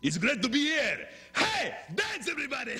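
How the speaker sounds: put-on high, loud partying Greek accent. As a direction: whoop it like a Greek party guy. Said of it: "this is my friend, Jorge from, uh, Greece".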